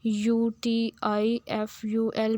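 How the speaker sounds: slow, letter by letter, each letter apart; careful teacher spelling aloud. Shown as a demonstration. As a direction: spell the word slowly for the class. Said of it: "जैसे मैं कह दूँ beautiful".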